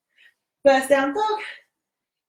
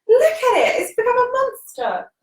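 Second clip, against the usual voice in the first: amazed exclamation; 9 semitones higher than usual. Real, not acted. Yoga teacher marvelling at her overgrown houseplant, amazed.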